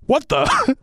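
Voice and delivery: high startled What the; squeaky baffled stormtrooper; stormtrooper surprise. Retold or imitated. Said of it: "the stormtrooper just goes".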